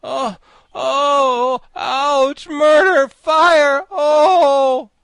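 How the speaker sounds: very high, loud howls of pain; drawn-out frantic wailing; cries of agony. A narrator's reading. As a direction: shriek as if writhing in pain. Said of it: "suffer such fearful pains".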